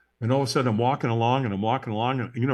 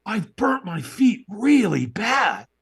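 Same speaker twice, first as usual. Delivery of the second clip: higher, slow, pained dismay; mock panic; 8 semitones higher, much slower than usual. After the walk, fearing burnt feet, high and slow. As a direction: whimper over burnt feet, drawn out. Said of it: "I go".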